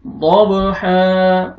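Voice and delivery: दब with a bouncing b, then हा held long; stretched haa. Shown as a demonstration. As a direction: bounce the b of दब and draw out हा. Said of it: "हा को खींचना है". (दब पर क़लक़ला, हा को खींचकर)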